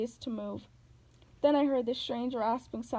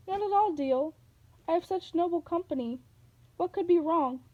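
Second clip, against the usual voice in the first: high, honeyed voice hiding menace; sinister sweet old lady; 6 semitones higher than usual. A narrator's reading. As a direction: purr innocently while unmasking. Monster feigning sweetness.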